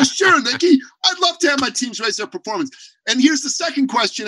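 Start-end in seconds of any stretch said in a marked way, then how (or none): 0.0-2.7 higher, louder eager "Sure, Nikki"; a keen CEO jumping at the offer; eager yes. Retold or imitated. Imagined CEO eagerly agrees.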